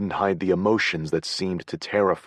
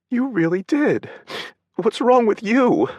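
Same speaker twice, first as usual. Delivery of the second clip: higher, louder mock accusation; teary indignation; 7 dB louder than usual. A narrator's reading. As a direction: turn it back on him through tears.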